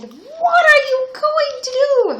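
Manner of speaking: high, loud horrified princess. A narrator's reading. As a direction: cry out in high-pitched alarm. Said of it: "Her face was quite horrified".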